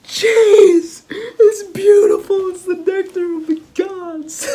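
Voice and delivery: very high, loud, overjoyed shriek; ecstatic cheese cry. Retold or imitated. Lizard Man overjoyed at the cheese, cries out in high, loud, rapturous wails. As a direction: near tears with joy over cheese. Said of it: "Lizard Man was like".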